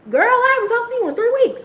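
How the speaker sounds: high, exaggerated scolding; mock friend's complaint. Friend calls after three weeks of silence, high mock complaint.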